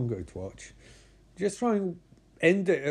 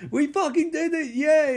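high, bright-pitched victory whoop; excited cheer; 10 semitones higher than usual. Retold or imitated. Characters celebrating their escape, high excited cheer. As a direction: cheer like you just pulled it off.

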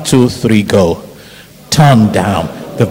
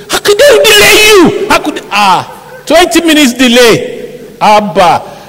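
much higher, louder mock outrage; annoyed pastor; 8 dB louder than usual. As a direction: play the pastor's exasperated outburst. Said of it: "Pastor Oki was so annoyed".

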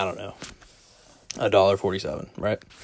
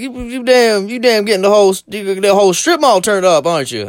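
customer's voice; 11 semitones higher, 8 dB louder than usual. Retold or imitated.

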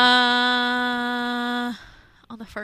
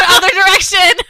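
laughing; 9 semitones higher, 13 dB louder than usual. Real, not acted. Laughing while directing.

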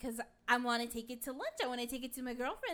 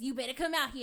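imitated insistent yell; louder call, repeating the demand; 3 semitones higher, 10 dB louder, faster than usual. Husband insists his wife come outside, yelling. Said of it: "he yells at me".

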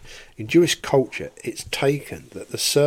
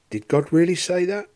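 quick, higher question climbing through "say that"; incredulous question; 7 semitones higher, 4 dB louder, faster than usual. Shown as a demonstration. Preacher replays the snake's question to Eve, incredulous. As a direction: ask it in rising disbelief, pushing on "say that". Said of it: "interesting way to hear that phrase".

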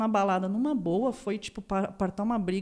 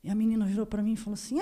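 ordinary talk

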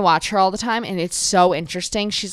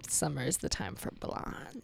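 whispered; 6 semitones lower, 18 dB quieter than usual. Retold or imitated. Quoted own past thought.

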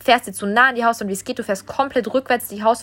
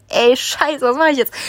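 raised, louder mock-panicked exclamation; retold alarm; 4 semitones higher, 5 dB louder, slower than usual. Facing fire all round the car, retold alarm. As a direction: replay the moment of being stuck.

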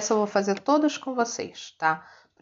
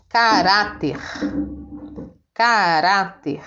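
caráter with a strong middle rá; emphatic caráter, rá strongest; stress on RÁ; slower than usual. Shown as a demonstration. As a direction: sound out caráter, leaning on the second syllable. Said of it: "a penúltima sílaba é a sílaba tônica".

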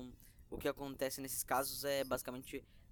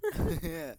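high laugh; 8 dB louder than usual. Real, not acted. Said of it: "laughing at joke".

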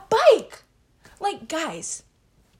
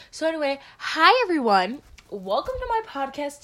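louder, upbeat welcome; bright podcast greeting; 2 semitones higher, 7 dB louder than usual. Shown as a demonstration. Teen host properly greeting her listeners, loud and upbeat. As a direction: greet the listeners with big energy. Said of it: "I need to, like, chill".

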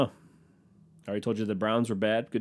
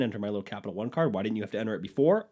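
usual speaking voice

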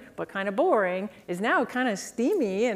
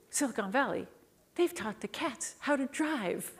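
awed revelation; 3 semitones higher, 7 dB quieter than usual. Retold or imitated. Consultant has a revelation about Uber for cats, hushed awe. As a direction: softly unveil an amazing discovery. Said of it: "then I had this revelation".

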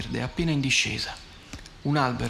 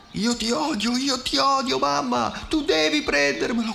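put-on very high, raging toddler voice; a tantrum yell, much higher; furious child; 12 semitones higher, slower than usual. A narrator's reading. Little girl raging at her mother over the toy, high furious wail. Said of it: "Con una rabbia".

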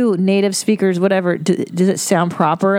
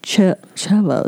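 mock British accent. Trying Churchill's name in British accent.

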